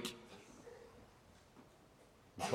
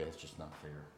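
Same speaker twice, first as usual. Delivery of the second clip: much softer, grumbling under his breath; mumbled under breath; 4 semitones lower, 16 dB quieter than usual. Acted. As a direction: mutter it to yourself as you walk away. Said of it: "mumble under his breath".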